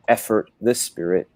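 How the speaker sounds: ordinary delivery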